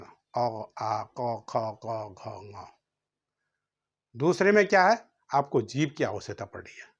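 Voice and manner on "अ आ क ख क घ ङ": अ, आ, क, ख, घ, ङ voiced deep from the throat; softer, lower, slow throat-sound drill; throat letters demo. Shown as a demonstration. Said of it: "कंठ से उच्चारण।".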